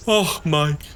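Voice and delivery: ordinary delivery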